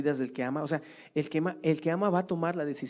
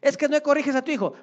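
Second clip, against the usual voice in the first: higher, louder, fast rebuke; louder preaching rebuke; 11 semitones higher, 7 dB louder, faster than usual. Retold or imitated. Preacher repeating his rebuke to a lax parent, louder and higher. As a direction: hammer the rebuke harder. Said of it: "estoy predicando y estoy diciendo".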